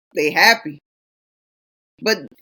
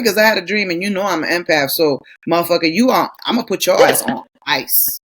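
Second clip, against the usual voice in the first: ordinary talk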